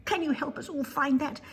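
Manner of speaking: small high voice begging for help; little bear asks Amy for help, high and pleading. Acted. Penny bear's pleading voice.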